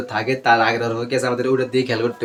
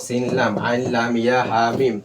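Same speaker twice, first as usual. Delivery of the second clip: ordinary talk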